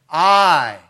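a long, firm "I" set off by a pause; stress on I. Real, not acted. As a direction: stretch "I" out and let it stand alone. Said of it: "there's emphasis in the original language".

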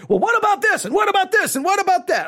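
high, pushy mimicking of a nitpicking churchgoer; a hammed-up busybody firing questions; mock challenger. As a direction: pitch it way up, needling him with questions. Mimics church member challenging him.

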